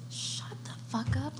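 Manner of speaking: slow, breathy hushed shut-up. Real, not acted. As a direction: hiss it slowly under your breath. Cuts off heckler mid-story.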